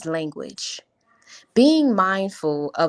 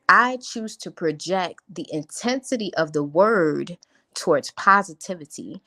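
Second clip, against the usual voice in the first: ordinary delivery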